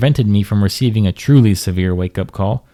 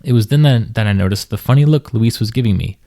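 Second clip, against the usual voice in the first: ordinary delivery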